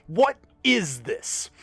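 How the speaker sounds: high, slow outraged cry. Acted. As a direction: stretch it out in outrage. Captain outraged at the list.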